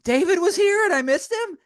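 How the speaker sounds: high, stunned disbelief; surprised dismay. Retold or imitated. Hearing from Joel that David had been in the room, high surprised dismay. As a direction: act like you can't believe you missed him.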